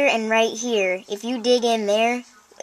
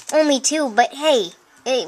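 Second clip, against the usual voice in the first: ordinary talk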